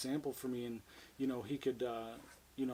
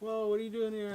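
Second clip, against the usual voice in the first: high, louder imitation of surprised customers; mocking customers; 9 semitones higher, 5 dB louder than usual. Customers spot him working behind the counter, high mocking imitation. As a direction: put on a high voice for the nosy customers.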